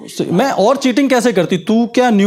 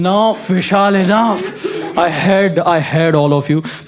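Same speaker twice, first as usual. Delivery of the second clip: slow fed up; 3 semitones lower, much slower than usual. Retold or imitated. Girlfriend quoted fed up.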